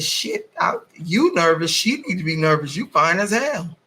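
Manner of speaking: animated, teasing reassurance with pitch swoops; flirty retold reply. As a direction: play up your surprised flattery. Retells her flirty reply to man.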